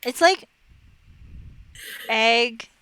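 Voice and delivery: ordinary delivery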